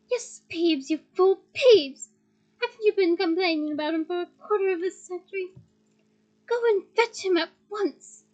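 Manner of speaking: loud, exasperated orders; impatient scolding. Acted. McGonagall orders Filch, loud and impatient. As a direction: drive it at the fool.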